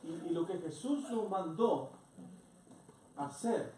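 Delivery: loud, high, driving sermon delivery; fervent preaching. Acted. Pastor rousing the congregation to preach, loud and fervent. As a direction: build it up like a pulpit call to mission.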